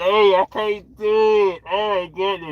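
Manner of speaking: high, drawn-out mock wail; mock distraught outburst. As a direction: act overwhelmed, stretching it out loud and high. Acts out crying every day.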